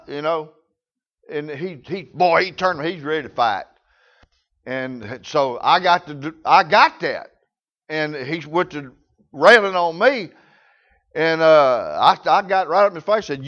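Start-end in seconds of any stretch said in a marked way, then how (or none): none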